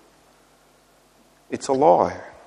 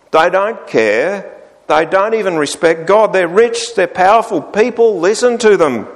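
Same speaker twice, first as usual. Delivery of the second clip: bitter envious rant; 6 semitones higher than usual. Retold or imitated. Asaph complaining to God about the wicked, bitter higher rant. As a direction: vent like a jealous man listing their luck. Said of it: "And he goes on".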